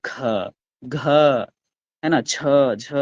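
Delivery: ख and घ pushed out with extra breath; ख घ छ झ को ज़ोर की साँस के साथ बोलना; breathy aspirates. Shown as a demonstration. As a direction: give ख, घ, छ, झ a strong puff of air. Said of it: "ख को बोलने में थोड़ा सा साउंड ज्यादा".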